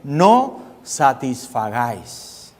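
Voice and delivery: drawn out low and slow, each syllable weighed; slow solemn emphasis. Acted. Preacher drawing out the verse for the congregation, slow and solemn. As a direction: lean into the command, slowly.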